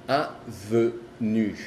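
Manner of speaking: avenue very slow, every sound clear; French avenue, slow and clear. Shown as a demonstration. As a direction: avenue drawn out, no nasal. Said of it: "ya no se dice en".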